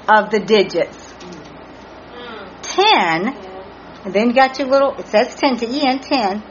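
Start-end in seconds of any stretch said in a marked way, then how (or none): none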